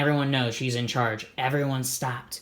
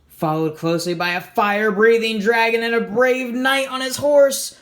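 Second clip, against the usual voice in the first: higher and louder, building excitement; excited narration; 6 semitones higher, 6 dB louder than usual. A narrator's reading. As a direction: tell it like the big moment of the story. Dragon and knight leap out.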